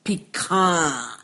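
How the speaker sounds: pecans with a long drawn-out last syllable, kahns; Texas drawl on pecans. Shown as a demonstration. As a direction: stretch the end of pecans, Texas style. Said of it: "With that Texas draw on the last syllable".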